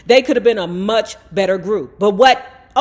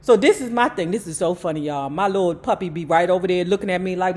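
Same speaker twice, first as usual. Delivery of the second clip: ordinary delivery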